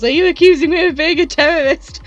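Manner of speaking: loud, high-pitched outraged yell; acted-out indignant shout; retold yell. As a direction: yell it like an offended accusation. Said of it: "he grabs Furu and yells".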